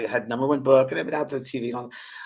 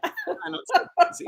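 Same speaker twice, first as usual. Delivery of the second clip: ordinary talk, nothing marked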